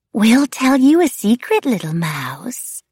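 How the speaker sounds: high, loud stage-secret lilt, slowing at the end; secretive wraith. A narrator's reading. Wraith offers the narrator a secret, eerie and conspiratorial. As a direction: tease it out high, drawing out the last words. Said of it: "Nicolina whispered".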